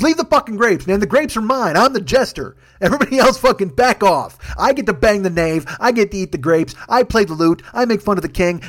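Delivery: hammed-up possessive outburst; indignant jester. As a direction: warn everyone off as the jester.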